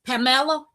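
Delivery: "Pamela" said pa-MELL-a, stress on the middle; Spanish mispronunciation. Retold or imitated. Passengers getting her name wrong, stressed pa-MELL-a for "Pamela". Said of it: "people get in my car talking about".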